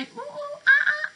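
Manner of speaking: high monkey hoots swelling to a loud ah ah; monkey call. Acted. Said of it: "And does he say".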